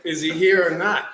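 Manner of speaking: repeating the line while still laughing; laughing retelling. Retold or imitated. Telling a friend the story, laughing through the quote. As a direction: crack up as you repeat the question.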